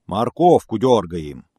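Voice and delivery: bear's roar. A narrator's reading. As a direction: bark it out like an angry bear. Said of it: "рявкнул медведь". (медведь рявкает громко и медленно)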